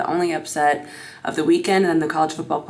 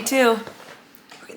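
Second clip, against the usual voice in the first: normal talking voice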